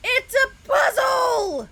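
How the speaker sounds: high, loud, slow excited shout. Real, not acted. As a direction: announce the puzzle with glee. Excited game announcement.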